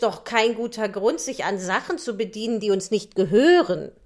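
loud moralizing lecture; lecturing glowworm. Acted. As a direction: preach the lesson loudly to the boy.

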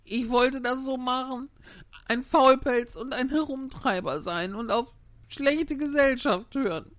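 high, mildly tearful self-reproach; weeping Pinocchio. Acted. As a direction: scold yourself through tears, pitched high. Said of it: "und sagte weinend".

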